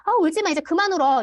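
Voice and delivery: mock scolding of a crying child. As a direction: snap at a crying child to stop. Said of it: "이렇게 얘기를 많이 하거든요".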